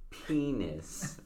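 high, quiet, stretched-out word; high soft punchline. Retold or imitated. Imitating the sugar cube video's sound, high soft voice.

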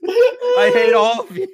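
laughing hard mid-sentence; cracking up while complaining. Real, not acted. Everyone calls at once.

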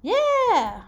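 high, loud, held-out cheer; elated, drawn-out shout; excited cheer. Real, not acted. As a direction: celebrate the right answer. Cheering a correct guess.

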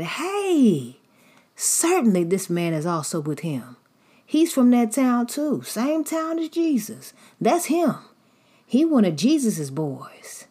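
high, loud, eager to point him out; the nosy stranger who is sure it's him; high excited accuser. Acted. Stranger insisting Peter is from Jesus' town, high and excited. Somebody else walked up and said.

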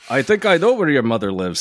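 stage-Irish lilt; Irish accent. Acted. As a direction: read it in a caricature Irish brogue. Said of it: "I can't differentiate two Irish accents".

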